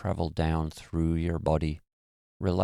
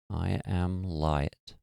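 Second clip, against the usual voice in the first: slow mantra; much slower than usual. Shown as a demonstration. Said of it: "So just keep repeating".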